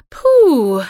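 loud, high sigh of relief; Fluttershy's sigh. A narrator's reading. Fluttershy is relieved her friend agrees, sighing out loud. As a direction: blow out a big, high "phew". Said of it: "Fluttershy seufzte".

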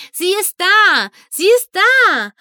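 high, loud cry of a big fan; excited shout. A narrator's reading. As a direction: call out the arrival, thrilled. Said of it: "rief Scutalo". (begeistertes, hohes Rufen)

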